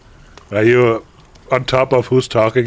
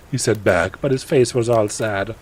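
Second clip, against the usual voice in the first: ordinary delivery, nothing marked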